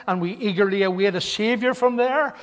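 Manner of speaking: loud, driving pulpit recitation; fervent scripture reading. Real, not acted. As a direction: read the verse out with mounting force.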